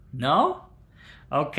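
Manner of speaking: ordinary talk, nothing marked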